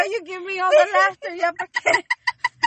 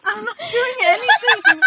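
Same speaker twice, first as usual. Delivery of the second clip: laughing; 12 semitones higher, 6 dB louder than usual. Real, not acted. Giggling through denial.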